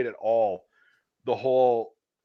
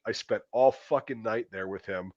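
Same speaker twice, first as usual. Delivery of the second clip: ordinary talk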